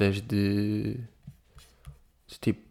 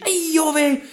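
put-on excited kid voice; 12 semitones higher than usual. Imitating excited kid on stream.

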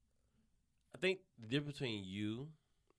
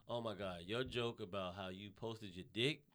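ordinary talk

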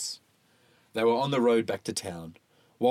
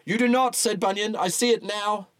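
accusing preacher; 12 semitones higher, 4 dB louder than usual. Acted. Bunyan's accusation.

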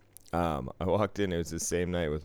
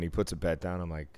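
ordinary talk

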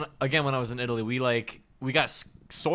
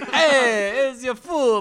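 high, loud mock Italian-Texan accent; mock waiter accent; 11 semitones higher, 8 dB louder, much slower than usual. Friend parodying waiter serving food, loud mock Italian-Texan accent. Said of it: "It had a hint of Italian".

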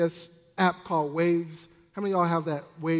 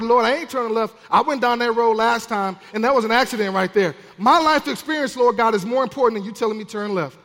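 protesting; 3 semitones higher, 7 dB louder than usual. Acted. Acting out a fearful believer arguing.

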